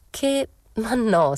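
high, slow hesitation; slow, drawn-out denial. Acted. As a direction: stretch it out unsure. Narrator hesitates, denying.

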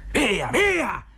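very high-pitched, exasperated shout; exasperated cry. A narrator's reading. Zotov driving out his animals, shrill exasperated cry. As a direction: cry it out high, at the end of your patience. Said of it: "gridò al colmo dell'esasperazione".

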